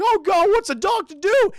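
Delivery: high, loud mock despair; hammed-up squealed lament; distressed cartoon dog. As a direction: imitate a dog at wits' end. Dog frets over missing owner.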